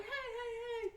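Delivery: very high, small-voiced hail; acted calling out. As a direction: mimic calling after a truck. Mickey hailing a passing truck.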